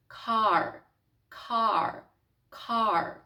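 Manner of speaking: car with a strong American r at the end. Shown as a demonstration. Teacher demonstrating the American r in car. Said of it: "їхній звук «р» звучить геть по-іншому".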